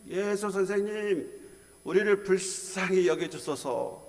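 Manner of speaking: acting out a group's cry for pity; imitated crying out. Ten lepers begging Jesus together, imitated cry. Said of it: "한 목소리로 소리를 지릅니다".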